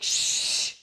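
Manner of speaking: whispered pastor's shh; mock church shush. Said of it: "And the pastor's like".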